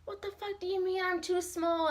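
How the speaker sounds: high, quiet, disbelieving comeback; mock indignant retort. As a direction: play being offended at being called small. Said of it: "I was like".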